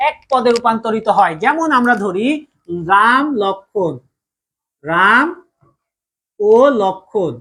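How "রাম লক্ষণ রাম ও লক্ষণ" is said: slow dictation. Shown as a demonstration. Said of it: "teacher dictates example slowly".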